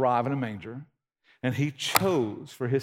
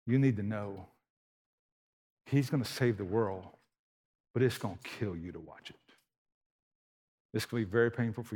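softer, lower, grave voice; hushed prophecy; 3 semitones lower, 5 dB quieter than usual. Retold or imitated. Simeon warning Mary about her son, softer and solemn. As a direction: tell her gently and solemnly. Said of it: "he said".

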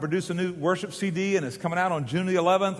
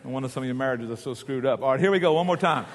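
usual speaking voice

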